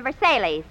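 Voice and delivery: Versailles said as "ver-SAY-leez"; mispronounced Versailles. Acted. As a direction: say Versailles as if spelled "ver-sailies". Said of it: "I'm quoting Phil".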